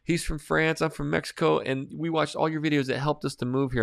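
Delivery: high-pitched imitation of a gushing woman; imitated fan introducing her husband; excited fan. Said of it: "And she's like".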